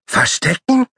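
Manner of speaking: high-pitched excited little animal; shrill repeated plea; squeaky child call. Acted. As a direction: chant it like a pestering puppy. Paule beams hopeful.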